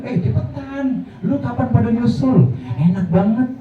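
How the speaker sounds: high-pitched, playful urging from beyond; beckoning dead believer. Pretend. Dead believer urging living friends to follow, high-pitched beckoning. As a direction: call friends over, pitched well up.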